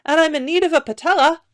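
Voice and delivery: high, quick character voice; new voice. A narrator's reading. New trader asking for a kneecap, high quick voice. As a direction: request it high and fast. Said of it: "came a new voice".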